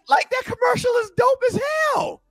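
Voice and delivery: very high-pitched hollered praise; excited shouting. Real, not acted. Host praising the spirit commercial, excited high holler.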